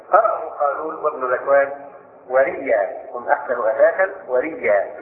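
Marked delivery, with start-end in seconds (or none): 2.3-4.9 "ورئيا" said wa-riyya, without a glottal stop; doubled ya, no hamza. Shown as a demonstration. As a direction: merge "ورئيا" into a long doubled y. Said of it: "بتسجيل الياء من غير همز".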